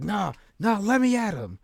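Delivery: high, eager mock-tough voice; eager Alex. Alex Wright begging to fight, high mock-eager. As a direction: raise the pitch, itching to fight. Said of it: "Alex Wright like".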